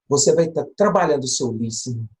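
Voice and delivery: usual speaking voice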